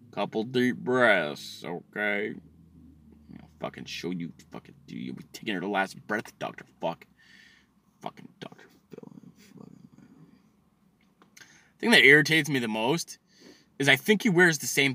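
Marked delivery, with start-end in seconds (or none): 0.1-9.1 low, very soft seething mutter; muttering to self. Pretend. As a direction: let it sink under the breath. Cooling down after mock outburst.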